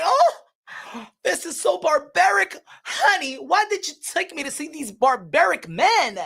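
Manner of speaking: hammed-up high, swooping dismay; mock-appalled wail with big pitch swoops; squeamish spectator. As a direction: act a dainty, horrified date at a fight. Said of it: "be watching a fight like this".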